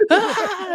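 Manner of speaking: high terrified yelp; high scream. Retold or imitated. Instructor mimicking the bodybuilder's scream, high and sudden. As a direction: imitate a man screaming in terror. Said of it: "but I can hear him".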